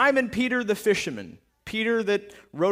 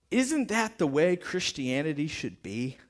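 ordinary delivery

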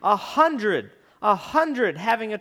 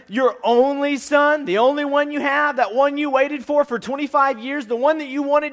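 pressing, louder voice; 6 semitones higher, 6 dB louder than usual. Retold or imitated. Pastor voicing God pressing Abraham.

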